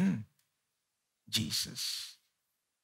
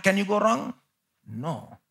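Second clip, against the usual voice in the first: ordinary talk, nothing marked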